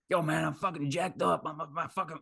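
high-pitched attempt at an American accent; Goggins accent. Podcaster mimicking Goggins on running through pain, mock American accent. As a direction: put on a hammy American runner's accent. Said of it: "I don't know why I'm trying his accent".

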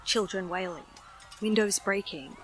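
ordinary delivery

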